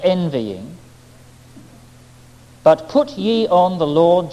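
slow, measured reading, stress on each not; solemn scripture reading. A narrator's reading. Convert reading the epistle aloud, slow and solemn. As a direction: read the verse like a revelation.

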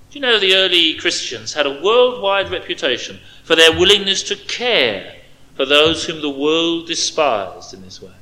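ordinary talk

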